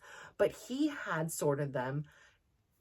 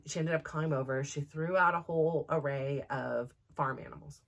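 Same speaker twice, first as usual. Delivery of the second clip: ordinary delivery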